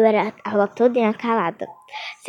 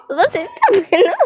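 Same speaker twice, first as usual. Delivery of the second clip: teacher's mock question; 7 semitones higher than usual. Teacher asking the laughing class.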